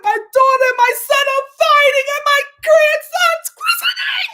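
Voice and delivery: high, loud mock shout of an upset grandfather; imitating No-No. Imitating No-No shouting about his fighting kids, high and loud. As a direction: belt it out like a frantic old man at a party. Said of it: "No-No was like".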